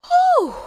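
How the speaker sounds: loud, high breath of relief; big relieved whew. Real, not acted. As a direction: let out all the tension. Relief after anxious scene.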